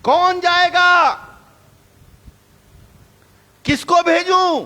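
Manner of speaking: a mournful divine call, shouted high; sorrowful cry. Acted. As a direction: cry out as God seeking someone to send. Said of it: "दुख भरी आवाज".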